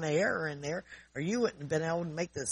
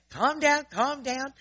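urgent calming; 9 semitones higher, 5 dB louder, slower than usual. Retold or imitated.

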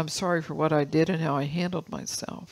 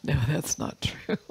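usual speaking voice

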